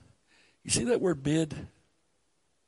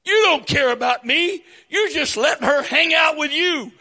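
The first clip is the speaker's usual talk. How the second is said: high, loud shouted whining; whiny accusation; 9 semitones higher, 6 dB louder than usual. Retold or imitated. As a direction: wail it like a wounded martyr. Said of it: "her accusation".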